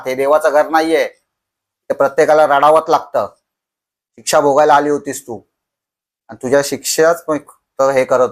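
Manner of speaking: loud, raving shouts at the ghost; crazed yelling. Retold or imitated. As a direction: yell defiantly like a man losing his mind. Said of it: "तो जोरजोराने ओरडायला लागला".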